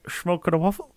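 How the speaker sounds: high put-on Dutch accent, asked as a joke; a jokey Dutch accent for the lads; silly Dutch accent. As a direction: throw out a cheesy Dutch accent. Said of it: "do a Dutch accent for me".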